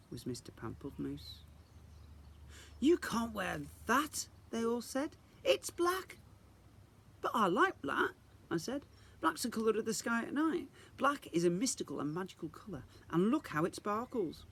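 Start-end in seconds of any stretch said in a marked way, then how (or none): 2.8-6.2 high, prim little fairy voices; chorus of disapproving fairies, pitched up; scolding fairies. Acted. 7.2-14.4 put-on high, eager little girl's voice; a young girl defending what she loves; Isadora, earnest.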